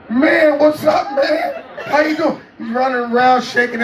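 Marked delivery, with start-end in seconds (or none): 0.1-2.4 high, giddy, laughing hello; high nervous fan greeting; starstruck reply. Retold or imitated. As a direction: sound overwhelmed meeting a hero. His own starstruck reply.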